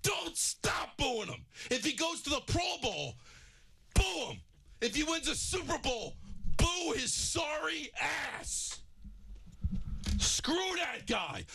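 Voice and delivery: high-pitched outraged ranting at a player; angry rant. Real, not acted. Host raging at the Giants lineman over his insult, furious ranting. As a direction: boil over, voice climbing high. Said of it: "that angers me".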